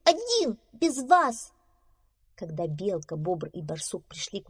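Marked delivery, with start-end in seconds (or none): 0.0-1.5 very high squeaky boast; defiant little mouse. Acted. Mouse left behind by friends, defiant squeak. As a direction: declare it in a tiny, high voice. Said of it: "крикнул мышонок".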